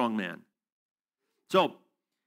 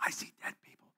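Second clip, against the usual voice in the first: hushed whisper, mimicking a frightened movie kid; very soft, voiceless impression of a child's whisper; imitated movie whisper; 14 dB quieter, faster than usual. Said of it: "that kid whispers louder than a normal man".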